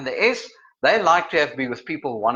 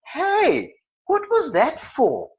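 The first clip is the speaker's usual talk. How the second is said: high, slow wounded complaint; mock hurt protest; 12 semitones higher, much slower than usual. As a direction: protest like someone mild who was just clipped. Acts the S clipped on head.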